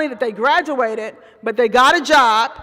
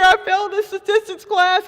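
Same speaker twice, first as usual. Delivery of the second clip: higher, mock-crying plea; replaying her own sobbing panic, lightly; retold tearful wail; 6 semitones higher than usual. To her professor, a higher, half-crying wail. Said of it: "bawling and crying like a nut".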